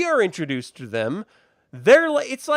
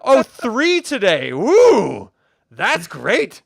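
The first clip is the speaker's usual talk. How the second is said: loud, slow fake excitement; hammed-up woo for three views; sarcastic mock cheer; 4 semitones higher, 9 dB louder, much slower than usual. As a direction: cheer at a pitiful number, dripping sarcasm. Mocking his own tiny view count.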